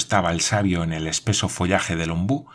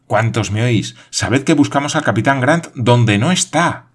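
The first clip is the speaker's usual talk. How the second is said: louder and higher announcement; exclaiming; 4 semitones higher, 5 dB louder than usual. Acted. Paganel announces to his friends, loud and excited. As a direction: declare the discovery to everyone. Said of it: "exclamó Paganel".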